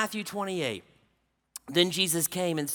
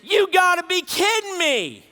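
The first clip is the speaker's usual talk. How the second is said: frustrated daughter; 10 semitones higher, 5 dB louder than usual. Retold or imitated.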